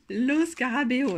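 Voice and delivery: "Lo Scarabeo" said slowly with a hammed-up Italian accent. Shown as a demonstration. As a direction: roll out "Lo Scarabeo" like an Italian, slow and emphatic. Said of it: "Et mon accent, euh, italien est vraiment". (accent italien exagéré et lent sur « Lo Scarabeo »)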